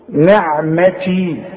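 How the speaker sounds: نعمتي slowly, the final yaa stretched; نعمتي with a long last vowel; held final vowel. Shown as a demonstration. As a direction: draw out the end of نعمتي. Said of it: "نعمتي إذا وقفت".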